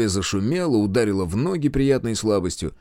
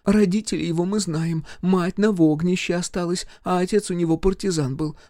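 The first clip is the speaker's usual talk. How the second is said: village mother-in-law; 8 semitones higher than usual. Acted. Mother-in-law scolding her husband at the wedding. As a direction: scold the drunk husband, then coax the major.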